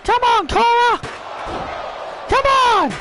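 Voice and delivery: high-pitched urging cry; urgent cheering. Real, not acted. Commentator urging Cora on, high excited cry. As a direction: call out to spur her on. Urging wrestler on during match.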